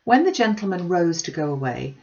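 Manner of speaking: usual speaking voice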